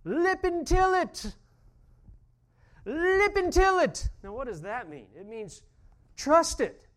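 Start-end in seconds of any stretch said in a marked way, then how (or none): 0.0-4.1 put-on high, loud, slow Scottish shout of an old lady; old lady shouting from the door in Scots; Scottish yell. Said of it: "she yells out". 6.2-6.9 urgent call. Retold or imitated. Voicing the old lady's meaning.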